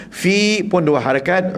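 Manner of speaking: ordinary talk, nothing marked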